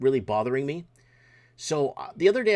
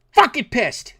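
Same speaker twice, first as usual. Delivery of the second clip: high, loud angry yell; angry shout; 8 semitones higher, 9 dB louder, slower than usual. Real, not acted. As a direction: blow up at them for real. Rant climaxes into shouting.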